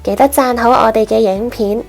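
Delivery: ordinary delivery, nothing marked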